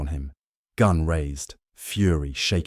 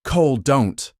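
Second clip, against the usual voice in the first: higher and louder, snapping the warning; sharp shout; 8 semitones higher, 7 dB louder than usual. Acted. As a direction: snap it out to stop him. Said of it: "Marcus snapped".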